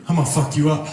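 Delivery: low, deadpan menace; mock cold threat; dead-faced threat. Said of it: "they just go dead face".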